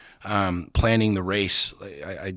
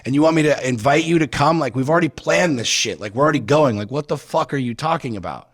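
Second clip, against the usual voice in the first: raised, fast exasperated rant; incredulous outburst at wife; angry retort; 5 semitones higher than usual. Retold or imitated. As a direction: fire back in disbelief, voice climbing. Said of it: "I'm like".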